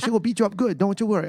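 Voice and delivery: high, badly imitated Thai accent; horrible Thai accent. Masseuse promises client a beating, high mock Thai accent. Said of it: "that's a horrible accent".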